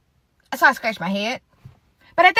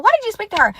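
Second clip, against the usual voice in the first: high, louder imitated reproach; accusing question; 10 semitones higher, 5 dB louder, faster than usual. Friend confronting her for greeting mom, high accusation. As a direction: demand it high, as the annoyed friend.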